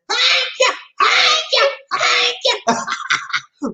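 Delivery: very high playful falsetto; comic sky-high thanks; squealed catchphrase. Pretend. Acts out what they always say.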